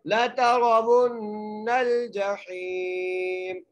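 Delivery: very slow chant, thick ra in तरवुन्नल; nasal ghunna on the doubled nun; heavy ra. Shown as a demonstration. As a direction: recite ला तरवुन्नल जहीम melodically. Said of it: "मीम को ... साकिन कर देंगे".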